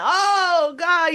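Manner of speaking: imitating the team's loud cry of dismay; imitated dismayed gasp. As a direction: play the players' shocked groan. Players react to coaching change.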